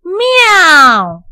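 high, loud cat's mew; kitten meow. A narrator's reading. Kitten in Heidi's pocket answers the housekeeper, high loud meow.

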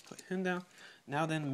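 normal talking voice

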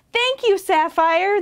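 high, lifted grateful cheer; happy high-pitched thanks; cheering animals. A narrator's reading. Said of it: "the animals cheered".

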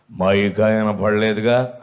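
slow, low grumbling village grandma; old woman's voice. Retold or imitated. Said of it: "అంది".

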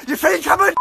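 ordinary delivery

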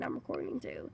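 hushed, near-unvoiced reply; sneaky aside to viewers; whispered aside. Real, not acted. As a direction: whisper it back conspiratorially. Whispered aside after plain line.

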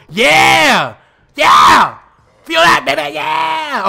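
loud whooping yeahs, high and hype; excited shouting. Real, not acted. Gamer cheering his own submission hold with loud yells. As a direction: yell like you just locked in the hold.

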